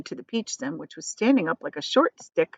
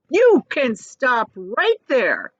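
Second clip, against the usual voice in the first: slow, sharp warning, louder; sharply; 6 semitones higher, 6 dB louder, much slower than usual. A narrator's reading. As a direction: cut him off coldly. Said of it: "said the earthworm sharply".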